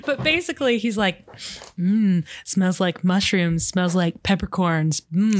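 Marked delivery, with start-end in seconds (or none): 1.8-5.4 slow sniffing. Acted. Jamie sniffing his wife.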